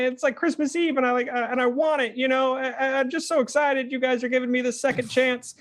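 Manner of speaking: very high-pitched, eager character voice; high, earnest little character, thrilled and rambling; excited Wardy. Acted. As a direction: gush in a high voice, stumbling over the excitement. Plays Wardy, excited to change.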